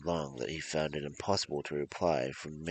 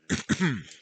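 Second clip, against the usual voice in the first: throat clear; 9 semitones higher, 6 dB louder than usual. Real, not acted. Throat clear between sentences.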